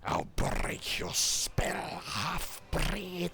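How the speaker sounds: high, very slow rasping snarl from a dragon-man; draconic snarl. A narrator's reading. Barbarian snarling at the half-breed sorcerer, raspy and high. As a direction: spit the threat out slowly, rasping and high. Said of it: "snarls the draconic barbarian".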